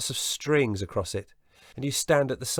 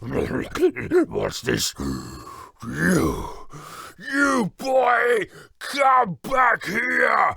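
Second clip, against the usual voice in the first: giant waking angry; 11 semitones higher, 6 dB louder, much slower than usual. Acted. Giant shouting after the thief boy, roaring. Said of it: "the giant woke up saying".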